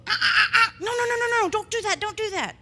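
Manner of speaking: high, rapid-fire reprimand; scolding a dog. Acted. Owner acting out telling naughty dog off, scolding. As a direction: stop a naughty dog in its tracks. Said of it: "Even if you go".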